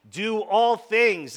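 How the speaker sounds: each word drawn out and loud; slow, loud and deliberate; slow emphatic scripture. Acted. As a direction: lean hard on the verse. Preacher emphasizes verse slowly.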